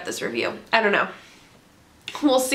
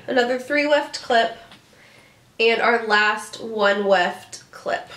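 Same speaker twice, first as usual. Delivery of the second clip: ordinary talk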